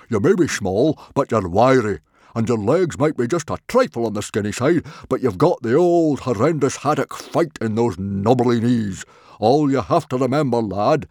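very loud, high hearty father; rally the boy like a coach; rousing booming pep talk. A narrator's reading. Stoic giving Hiccup a loud pep talk on the beach.

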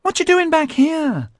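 put-on very high, squeaky girlish greeting. A narrator's reading. Tiger Pansy greets Melanie backstage, squeaky. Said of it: "she squeaked".

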